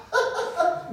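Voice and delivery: high-pitched acted guffaw; crazy laugh. Foreman hearing the bear's claim, crazy laughter. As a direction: burst out laughing like a madman. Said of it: "empezó a reírse como loco".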